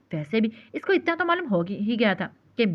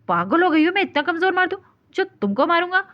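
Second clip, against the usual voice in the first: higher and louder, outraged; furious; 8 semitones higher, 4 dB louder than usual. A narrator's reading. Husband furious at being called a wife-beater. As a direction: explode at the accusation. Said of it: "Mir गुस्से से पागल हुआ".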